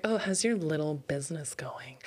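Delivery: unvoiced, condescending whisper of a question; snide whisper. A condescending acquaintance to a small business owner, whispered and snide. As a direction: breathe it out with fake sweetness. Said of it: "they're saying that, like, that way so intentionally".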